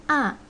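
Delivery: short falling "a"; fourth tone. Shown as a demonstration. Teacher demonstrating four tones, falling "a". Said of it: "four tones".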